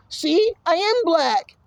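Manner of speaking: high, slow put-on kid voice; drawn-out high mock protest; mock insistent kid. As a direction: insist in a high childish whine.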